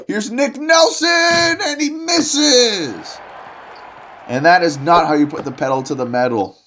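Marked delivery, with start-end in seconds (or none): 0.1-3.2 excited call. Acted. Announcer shouts to the crowd as the shot misses, excited high shout. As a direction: yell the miss like a hyped announcer.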